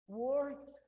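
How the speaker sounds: very slow, each word laid out; slow reading. Shown as a demonstration. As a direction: read it out slowly for the class. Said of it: "O que que significa isso".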